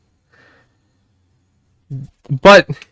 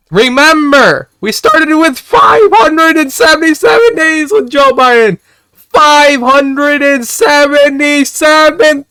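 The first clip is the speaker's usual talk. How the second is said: much higher, shouted, dragged-out rant; furious rant; 11 semitones higher, 4 dB louder, slower than usual. Real, not acted. To chat about Biden's vacation days, a furious shout. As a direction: yell it out, outraged at the days off. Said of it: "Oh, I'm so mad right now".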